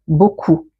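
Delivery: ordinary talk, nothing marked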